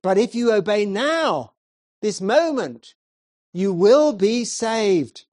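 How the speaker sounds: high and loud, slowing on the promise; preacher urging young man, loud and earnest; loud earnest preaching. Acted. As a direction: press the warning, then offer salvation. Preacher warning then promising.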